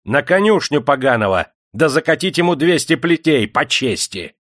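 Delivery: loud, high command; shouted order. A narrator's reading. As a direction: shout the sentence to the servants. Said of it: "закричал".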